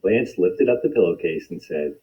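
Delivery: ordinary delivery, nothing marked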